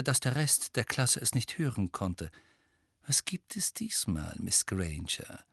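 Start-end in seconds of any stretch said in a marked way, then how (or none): 3.1-5.4 hushed, softened voice; whispering. A narrator's reading. Teacher leans down to Hermine so the class can't hear, whispering. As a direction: lower the volume so only she hears. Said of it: "und flüsterte".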